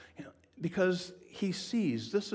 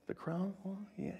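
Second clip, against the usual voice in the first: usual speaking voice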